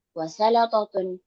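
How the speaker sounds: ordinary delivery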